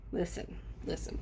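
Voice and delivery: whispered listen, listen. Real, not acted. Calming viewers down, a whispered listen. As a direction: hush them in a whisper. Hushed after the mock cheer.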